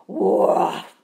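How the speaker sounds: a loud bear woof; loud bear roar. A narrator's reading. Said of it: "went the bear".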